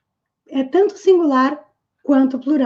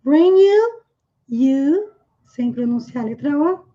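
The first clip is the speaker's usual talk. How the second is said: usual speaking voice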